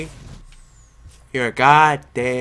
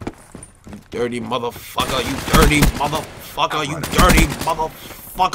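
ordinary talk

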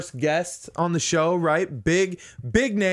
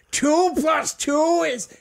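high, loud, drawn-out yell; yelling Gilbert Gottfried; 12 semitones higher, 5 dB louder, much slower than usual. Acted. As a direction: holler it slowly like an over-loud kid in class, stretching the last word. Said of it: "He was in class yelling everything".

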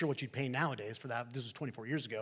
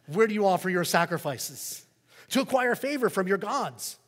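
higher, louder, chatty skeptical neighbor; Roman neighbor; 5 semitones higher, 8 dB louder than usual. Acted. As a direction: play the curious pagan neighbor, bright and pushy. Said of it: "the neighbor says".

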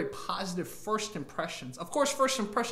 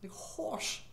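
British horse; 7 semitones higher, 12 dB quieter, much slower than usual. Shown as a demonstration. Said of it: "how would a British person pronounce horse".

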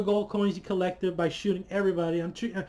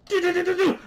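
high, loud imitated gunshots sung on do; mock gunfire; 11 semitones higher, 4 dB louder, faster than usual. Fan imitating John Wick shooting, mock gunfire. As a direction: rattle off the shots like a kid playing.

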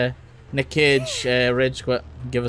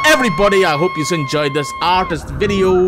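youtuber outro; 6 semitones higher than usual. Acted.

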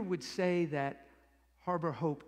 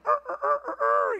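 high-pitched strutting rooster noise; rooster crow imitation; 7 dB louder than usual. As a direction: crow like a cocky little rooster. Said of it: "strutting around like a banty rooster".

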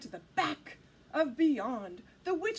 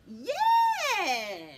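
loud, high, stretched-out yes; shrill it out long, like a witch; witch's cry; 4 semitones higher, 8 dB louder than usual. Acted. Witch welcoming the dog aboard, crying out. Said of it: "cried the witch".

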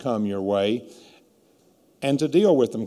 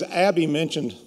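ordinary talk

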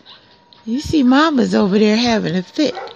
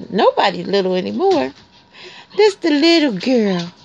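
ordinary delivery

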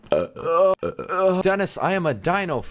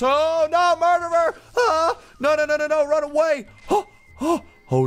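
panicked squeals; 3 dB louder than usual. Real, not acted. Murderer appears, panicked squeals.

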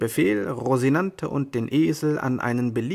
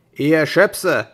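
loud, rough yell; millers shouting; 3 semitones higher, 9 dB louder than usual. Acted. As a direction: yell the insult across the water. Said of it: "schrien die Müller".

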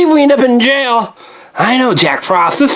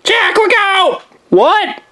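high, sudden shout of warning; alarmed shout; 10 semitones higher, much slower than usual. Acted. Olaf shouting to warn Jack, then Jack crying out as the trap hits. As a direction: yell out the warning, then the groan.

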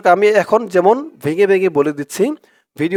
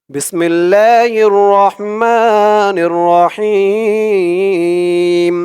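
chanted prayer with drawn-out vowels; টেনে টেনে দোয়া পাঠ; slow recitation. Shown as a demonstration. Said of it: "টেনে টেনে পড়ব".

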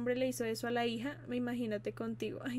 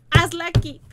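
loud, higher call across the room; 5 semitones higher, 14 dB louder than usual. Real, not acted. Telling a friend to do her homework right there, called out loudly.

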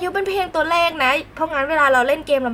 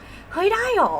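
high, slow, disbelieving "ได้หรอ" with a falling end; lightly acted stunned "really?"; shocked disbelief; 8 semitones higher, slower than usual. Streamer retelling her reaction to the old fan, in mild mock disbelief. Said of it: "แบบช็อก แบบช็อกแบบสุดๆ".

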